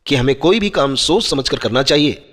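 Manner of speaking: plain reading voice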